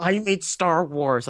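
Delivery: high, drawn-out boast; pompous director announcing his credit; J J Abrams. Acted. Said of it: "I'm J J Abrams".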